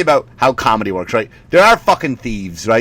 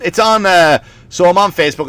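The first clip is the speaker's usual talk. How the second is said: usual speaking voice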